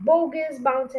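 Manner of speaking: ordinary delivery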